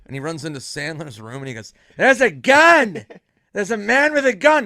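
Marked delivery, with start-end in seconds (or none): 2.0-4.6 high, loud warning yell; mock alarm yell. Norm running into Sandler's room, high loud mock alarm. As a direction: raise the alarm.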